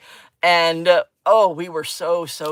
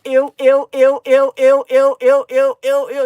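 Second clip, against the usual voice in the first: loud, high squeals of disgust; 7 semitones higher, 10 dB louder than usual. Real, not acted. Crafter reacting to sticky residue, loud disgusted squeals. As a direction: squeal it out like an alarm going off. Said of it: "my sensory thing is going".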